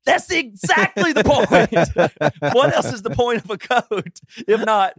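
high, slow, laughing through the comeback; laughing retort. Retold or imitated. Husband arguing back to his wife, laughing and slow. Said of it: "To which I respond".

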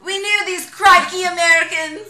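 loud, high, laughing fake Australian accent; mock Australian listeners. Imagined Australian listeners mocking Americans, fake Australian accent. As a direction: put on a silly Australian accent while laughing. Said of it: "and they're like".

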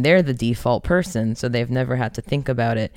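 ordinary talk

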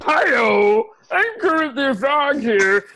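high, slow frog puppet voice; a strained frog puppet greeting; Kermit the Frog voice. Retold or imitated. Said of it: "we all do that".

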